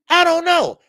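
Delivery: sudden high, loud outburst; exploding shout. Real, not acted. Annoyed host shouting at the referee question. Same question asked again, now erupting.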